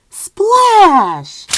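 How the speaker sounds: loud punched splash; splashy sound word; big splash. Acted. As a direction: make the splash land.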